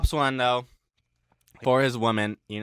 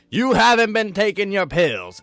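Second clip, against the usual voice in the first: higher, louder scolding accusation; accusing shout; 9 semitones higher, 9 dB louder than usual. Retold or imitated. Wife confronting husband about his pills, loud and accusing. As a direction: bark the accusation at him.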